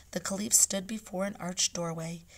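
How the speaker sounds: usual speaking voice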